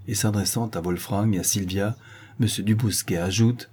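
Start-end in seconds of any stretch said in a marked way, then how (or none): none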